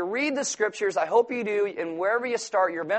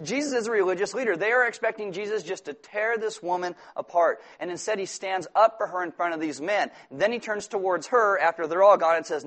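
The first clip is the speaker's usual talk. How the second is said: normal talking voice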